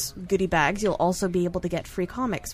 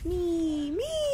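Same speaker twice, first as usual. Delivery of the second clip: eager me; 9 semitones higher, 5 dB quieter than usual. Real, not acted. Guest squeaks excitedly.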